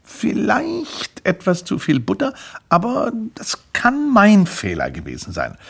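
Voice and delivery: high, slow, pondering voice; fussy customer. Acted. Man judges the buttered sample slice, high fussy voice. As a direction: weigh up the verdict as a picky taster.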